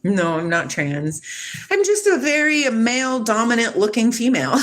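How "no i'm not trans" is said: ordinary talk, nothing marked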